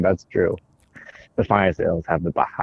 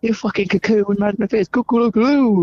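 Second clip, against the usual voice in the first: squeaky high voice; 11 semitones higher, 3 dB louder than usual. Pretend. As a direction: squeal it up in a tiny voice.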